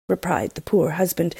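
usual speaking voice

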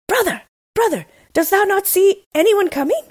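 very high, desperate call; pleading cry, pitched right up. A narrator's reading. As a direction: call urgently up to your brother. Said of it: "and then cried".

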